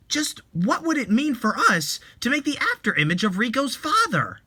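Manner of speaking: high, agitated protest; upset objection. Acted. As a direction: push back, offended. Relatives object angrily.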